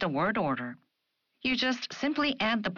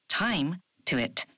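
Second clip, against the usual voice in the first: usual speaking voice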